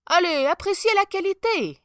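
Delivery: high, loud salesman's patter; swindling tailor. A narrator's reading. Tailor urging the emperor to admire, pushy.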